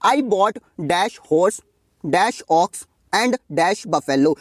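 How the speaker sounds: very slow read-aloud of the question; slow dictation. Shown as a demonstration. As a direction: read out very slowly, word by word. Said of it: "reads the exercise sentence aloud".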